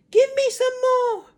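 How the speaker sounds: put-on very high, loud cackling old woman; screechy crone. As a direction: cry out like a greedy hag. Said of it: "she cried".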